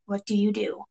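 soft, ominous question; quietly posed dilemma; hushed suspense. A narrator's reading. Narrator poses the dilemma.

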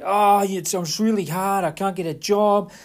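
mock self-pity; whiny excuse. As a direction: complain like a lazy young man. Brother's excuse retold.

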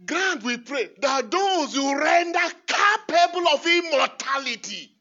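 loud, high ringing proclamation; fervent proclamation. Acted. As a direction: proclaim it to the church. Proclaiming the prayer.

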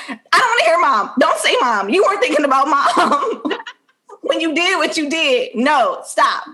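hammed-up scolding that slips into laughter; mock angry mom. Mom cutting off Bria's pleas, high mock-angry rant. Acted mother furiously scolding daughter.

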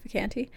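soft Vacanti with the A of "cat"; surname with short A. Shown as a demonstration. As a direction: murmur Vacanti as vuh-KAN-tee. Trying out the surname's pronunciation.

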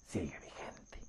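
deep menacing whisper; ominous whisper. Acted. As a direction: hiss the curse out slowly. Wolf ends his curse tale.

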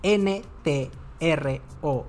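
slow letter-by-letter spelling; deletreo pausado. Real, not acted. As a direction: spell it out slowly, pausing between letters. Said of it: "se los voy a deletrear". (deletrear despacio, letra por letra)

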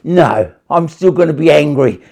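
loud stubborn mock anger; stubborn angry audience member. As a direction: act out a grumpy listener digging in. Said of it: "sitting there like that going".

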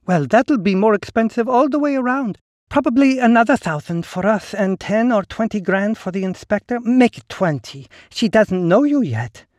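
high, silky and knowing; smooth woman's voice. Acted. Ligny character voice.

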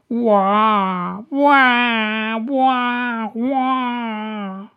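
high, loud howling sob; dragon wailing sobs. A narrator's reading. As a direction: wail like a crying dragon. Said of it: "los llantos".

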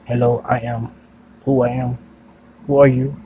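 a lightly voiced robot, loud and stiff. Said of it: "Oh, robot bitch".

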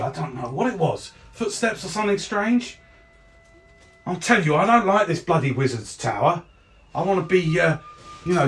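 high-pitched complaining guard; aggrieved guard voice, pitched well up; rattled, grumbling guard. Acted. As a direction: protest to a doubting colleague. First guard protests and grumbles.

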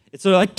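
sudden startled gasp; mock startled gasp on waking. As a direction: gasp like a man jolted awake. Imitating waking father.

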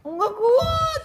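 high, very slow wailing sob; imitating crying wife. Husband mimics his crying wife in labour, high slow sobbing wail. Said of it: "Dia tuh udah nangis".

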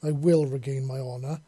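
ordinary delivery, nothing marked

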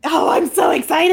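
high, loud excited squeal; loud gleeful shout. Real, not acted. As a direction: burst out with glee. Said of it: "delighted outburst".

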